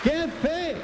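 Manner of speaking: high-pitched mock amazement; amazed onlookers. Preacher quoting people who heard his testimony, high amazed exclamation. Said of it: "Y la gente decía".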